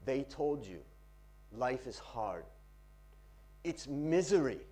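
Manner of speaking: measured recital with long pauses; poetry reading. Shown as a demonstration. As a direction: recite it phrase by phrase, letting silences hang. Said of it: "Practice reading poetry".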